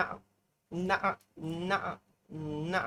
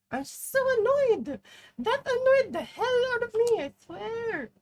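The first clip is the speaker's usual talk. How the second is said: squeaky annoyed whine; 7 dB louder than usual. Real, not acted. Annoyed rant at teammates.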